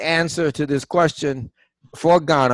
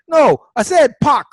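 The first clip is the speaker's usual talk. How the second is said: higher, louder exasperated retort; loud retold comeback to the cab driver; mock insistent yell; 12 semitones higher, 5 dB louder than usual. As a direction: insist hard, like arguing with the driver over the fare. Retold argument with taxi driver.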